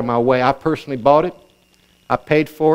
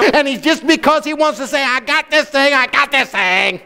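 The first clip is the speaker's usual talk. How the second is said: rising, driving preacher build to a high climax; escalating pulpit fervor, pitched high at the end; fervent preaching; 6 semitones higher than usual. Acted. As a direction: preach it up into an exultant boast.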